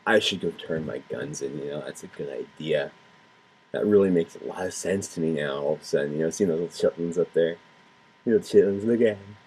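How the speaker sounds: low, soft, dopey mock thug; mock criminal. Imitating a criminal moved by the kids, low and soft. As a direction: drop low and quiet like a dim crook. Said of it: "the criminal sees the kids and goes".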